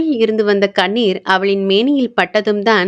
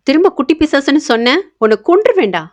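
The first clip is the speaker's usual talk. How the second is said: high-pitched playful threat; mildly cross, raised-pitch warning; mock angry; 6 semitones higher, faster than usual. A narrator's reading. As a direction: warn a teaser with acted outrage. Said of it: "Anandhi கோபமாக".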